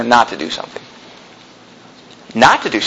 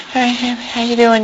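softer, put-on mock greeting from a timid pastor; 3 semitones higher, 6 dB quieter than usual. Preacher mocks a soft pastor greeting the church, softer put-on voice. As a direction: mimic a soft-spoken pastor with no conviction. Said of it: "talk like [name] or something".

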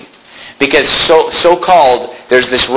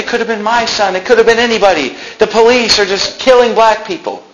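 much higher mock alarm; mocking protesters; 10 semitones higher than usual. Preacher mimicking protesting people, much higher mock alarm. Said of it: "these people are saying".

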